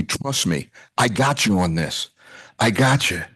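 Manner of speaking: soft, low, gentle reassurance; hushed voice of God. Retold or imitated. God quietly reassuring a worried husband, hushed and gentle. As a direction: let it land softly, like a promise. God's reassuring message, heard in prayer.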